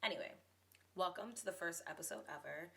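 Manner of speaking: normal talking voice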